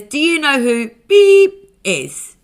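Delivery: imitating a coworker. Retelling how the coworker asked about the celeb, mock voiced. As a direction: ham up the coworker's big question. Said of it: "one boy comes in and says".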